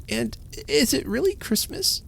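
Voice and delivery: put-on high, rising child's question of wonder. A narrator's reading. Little girl asking her mother, wondering. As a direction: ask it wide-eyed, hardly believing.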